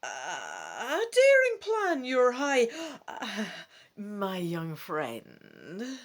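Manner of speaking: hoog en heel langzaam, met een zucht halverwege; oude vrouw die zich verspreekt. Acted. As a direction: hap even terug na de verspreking. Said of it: "catching her slip in mid-sentence".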